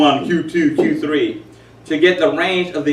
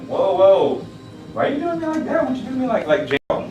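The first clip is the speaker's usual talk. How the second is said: much higher, put-on voice of the wife; imitating wife; 11 semitones higher than usual. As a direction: mimic the wife complaining. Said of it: "when my wife does my voice like that".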